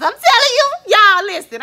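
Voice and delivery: high, loud laughing exclamation; giddy call for attention; excited outburst. Real, not acted. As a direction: burst out to the viewers, laughing. Laughing burst at the kids.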